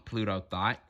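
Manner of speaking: ordinary delivery, nothing marked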